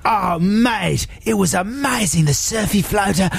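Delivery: high, hammed-up gushing Aussie mate; badly faked Australian, raving about it; mock Australian accent. Australian friend raves about surfy floater dinner, mock Australian accent. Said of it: "they're very poor Australian accents".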